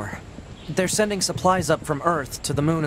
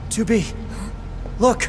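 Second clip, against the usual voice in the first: urgent call; 6 semitones higher than usual. Acted.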